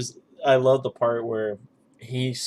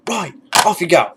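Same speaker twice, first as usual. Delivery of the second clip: louder, higher barked dismissal with "off" punched; mock sergeant barking "Right, off you go"; sergeant major dismissal; 6 semitones higher, 6 dB louder than usual. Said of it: "quoting some of those, those movies".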